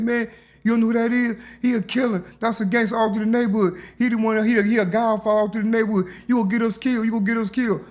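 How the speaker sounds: scared friends hissing a warning about the killer. Retold or imitated. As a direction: warn him like you fear for your life. Scared friends warn him.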